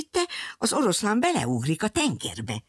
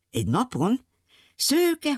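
usual speaking voice

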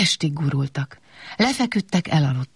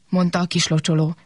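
usual speaking voice

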